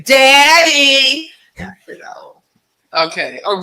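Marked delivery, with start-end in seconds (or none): 0.0-1.3 higher, louder call of Daddy; mock yell across a room. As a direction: hail her from across the room. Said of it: "people will scream across the room".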